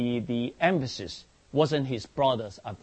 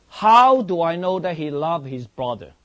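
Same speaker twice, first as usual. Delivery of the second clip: forceful rhetorical question; 5 semitones higher, 10 dB louder than usual. Real, not acted.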